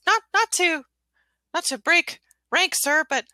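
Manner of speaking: high, hesitant little general; gingerbread man voice. Acted. Gingerbread general asking about a briefing, high hesitant voice. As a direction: pipe up haltingly, careful of rank. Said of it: "he still doesn't have a deep voice 'cause he's a gingerbread man".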